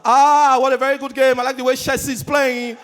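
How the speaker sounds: put-on loud, high Benin accent; Victor Ikpeba. Pundit praising Chelsea on TV, Benin accent. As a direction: gush about the match in a thick Benin accent. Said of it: "the custodian of the Benin accent".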